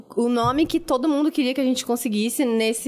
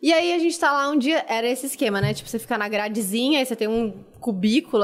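ordinary talk, nothing marked

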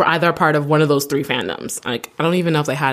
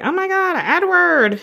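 very high-pitched mock fangirl squeal; hammed-up, high excited cry; gushing fan; 14 semitones higher than usual. Imitating gushing fans' reaction.

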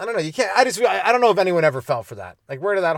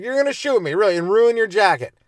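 higher, scoffing sarcasm; mock the threat, pitched up; sarcastic high-voiced scoff; mock disbelief; 6 semitones higher, 6 dB louder than usual. Mocking the fake gun bluff.

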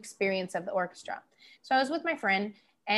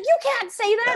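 mock scolding dad; 12 semitones higher, 6 dB louder than usual. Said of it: "And he's like".

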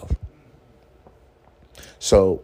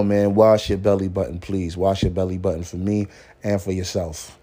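ordinary talk, nothing marked

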